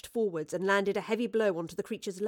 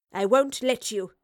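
slow, firm and louder defiance; stern; 3 semitones higher, 7 dB louder than usual. A narrator's reading. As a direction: stand firm, stressing it sternly. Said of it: "the professor said sternly".

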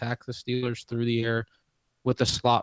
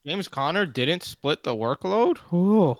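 sarcastic; 4 semitones higher, 4 dB louder than usual. Pretend. Sarcastic mock surprise at obvious point.

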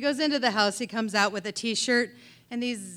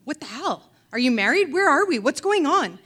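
rising higher and louder with each question; alarmed questions; 4 semitones higher, 6 dB louder than usual. Retold or imitated. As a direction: caught off guard and panicking. Shocked to hear of a baby.

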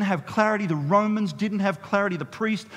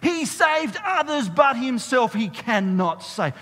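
jeering crowd; 6 semitones higher, 5 dB louder than usual. Retold or imitated. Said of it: "the crowd saying".

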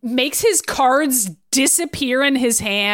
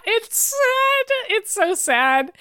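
mock sad; 11 semitones higher, much slower than usual. Mock-pitying reaction to the story.

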